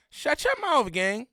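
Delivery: indignant telling-off; angry rebuke. Real, not acted. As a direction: shut them down, pitched high. Heated rebuke to another commentator.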